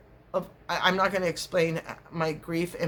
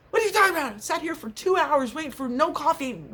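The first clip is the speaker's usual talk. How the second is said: exasperated outburst; 9 semitones higher than usual. Retold or imitated. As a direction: blow up in disbelief at him. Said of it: "I'm cussing".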